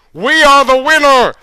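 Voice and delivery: high, loud victory cheer. Acted. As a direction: cheer the win. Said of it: "cheered Indian Jones".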